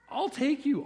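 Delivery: God eagerly promising to carry you; eager promise. Retold or imitated. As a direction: promise it with lifted excitement. God's promise, voiced high.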